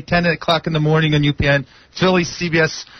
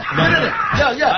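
high giggly urging; laughing; 9 semitones higher than usual. Real, not acted. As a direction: laugh and egg them on. Coaxing a hug, laughing.

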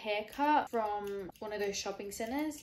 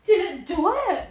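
much higher and louder, thrilled; excited cry; 8 semitones higher, 14 dB louder than usual. Real, not acted. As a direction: burst out in hopeful excitement. Thrilled haircut reveal.

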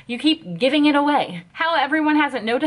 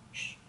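shushing; 19 dB quieter than usual. Pretend.

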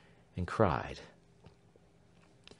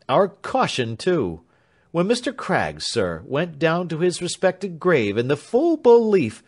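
firm defence; 7 semitones higher, 6 dB louder than usual. A narrator's reading. Lawyer defends firmly.

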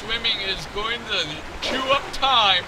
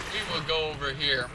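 ordinary talk, nothing marked

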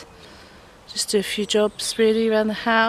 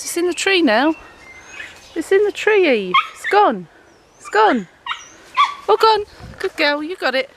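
high, louder pet voice; excited dog talk; 8 semitones higher, 3 dB louder than usual. Real, not acted. Owner tells dog the squirrel has gone, high excited pet talk. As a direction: cheer the dog on in high bursts.

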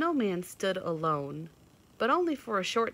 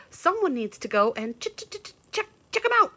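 high squirrel stuttering on ch; stammering squirrel; 11 semitones higher, 10 dB louder, faster than usual. A narrator's reading. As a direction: trip over the word check. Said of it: "chattered the squirrel".

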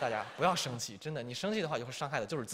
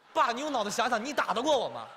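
cheeky retort; 7 semitones higher, 4 dB louder than usual. Retold or imitated. 建国顶撞父亲.

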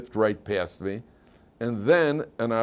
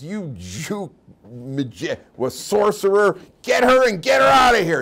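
furious shout, climbing much higher and louder; berserk Nazi; 10 semitones higher, 8 dB louder, slower than usual. Retold or imitated. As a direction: start drawn out, then explode into a high, raging yell. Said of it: "the Nazi goes berserk".

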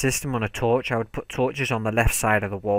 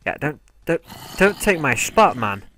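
loud, raised telling-off; angry scolding; 6 semitones higher, 6 dB louder than usual. Real, not acted. Gamer yells at a mob taking his spot. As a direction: shout the mob off the bridge.

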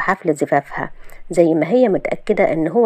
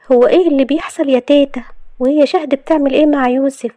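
high, insistent questioning; bewildered Salma; 7 semitones higher, 4 dB louder than usual. Acted. Salma asking her grandmother what is going on, bewildered. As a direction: demand an explanation in a raised pitch.

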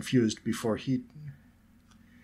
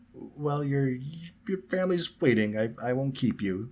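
hesitant, higher, faltering politeness; nervous; 5 semitones higher, slower than usual. A narrator's reading. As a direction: stumble nervously through a polite excuse. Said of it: "looking around a little nervously".

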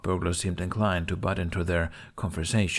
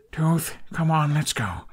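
pleading; 7 semitones higher, slower than usual. Acted. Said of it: "he pleaded".

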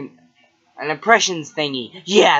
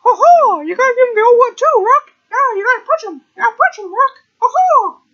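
much higher, louder, fast hyped voice; cartoon cheer voice; 6 dB louder, faster than usual. Cartoon coach urging the punch, high and loud.

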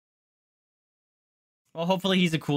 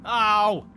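sharp loud ow; 5 semitones higher, 5 dB louder than usual. Real, not acted. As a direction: yelp of pain. Sudden pain cry in game.

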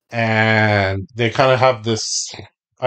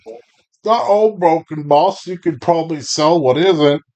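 pleading bandit underling; 6 semitones higher than usual. Acted. Bandit placates boss over broken crate, pleading underling. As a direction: plead your case in a raised pitch.

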